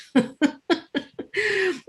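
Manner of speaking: laughing. Real, not acted. Laugh after correcting the record.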